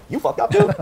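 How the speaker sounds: sharp, high, loud telling-off; mock tough-love yell; blunt call-out. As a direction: bark it like a harsh truth-teller. Imagined blunt truth-teller.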